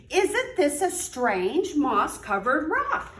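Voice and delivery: lively storybook read-aloud; reading to children. A narrator's reading. Teacher reading aloud to young children, animated storytelling. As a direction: read it like story time for little kids.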